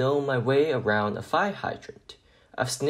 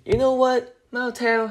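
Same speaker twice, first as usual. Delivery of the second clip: much higher, louder eager question; excited; 11 semitones higher, 4 dB louder than usual. Acted. Arfie answering the mail carrier, bursting with excitement. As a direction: burst with puppy joy. Dog thrilled by the offer.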